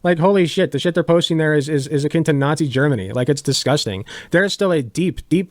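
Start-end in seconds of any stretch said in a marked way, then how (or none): none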